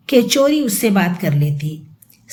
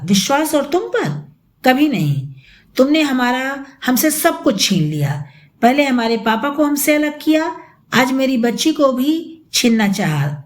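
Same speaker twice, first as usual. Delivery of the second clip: ordinary delivery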